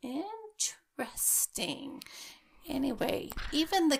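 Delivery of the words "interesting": high drawn-out whisper; spooky whisper. Acted. Spooked by the ghost app's word, a slow high whisper. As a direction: breathe it out slowly, spooked.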